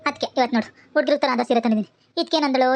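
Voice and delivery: usual speaking voice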